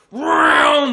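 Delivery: loud, high imitation of a car zooming by; shouted engine vroom; roaring engine noise. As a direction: roar past like a speeding car. Imitating cars speeding past.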